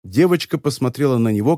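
ordinary delivery, nothing marked

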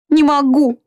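first plea, already rising; desperate exclamation. A narrator's reading. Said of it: "восклицала Марианна". (отчаянный высокий возглас)

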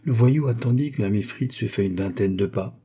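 plain reading voice

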